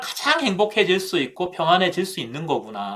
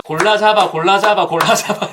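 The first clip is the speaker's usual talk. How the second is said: lightly voiced vendor hawking, a bit louder; market vendor call; 3 semitones higher, 5 dB louder than usual. Market vendor lightly imitated to the congregation, hawking. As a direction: mimic a market seller calling out to shoppers. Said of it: "이렇게 하면서".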